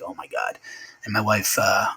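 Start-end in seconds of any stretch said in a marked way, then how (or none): 0.0-0.6 breathy whispered oh my God; barely voiced, the news sinking in; whispered shock. Realizing his friend died.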